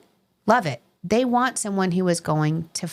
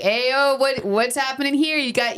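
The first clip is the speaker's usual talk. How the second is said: higher-pitched New York doorman calling out; New York accent; 7 semitones higher, slower than usual. Retold or imitated. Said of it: "I'm doing my New York accent".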